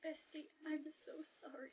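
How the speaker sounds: very soft, slowing apology; barely audible, grieving goodbye; sorrowful farewell. Acted. Final farewell to dying friend.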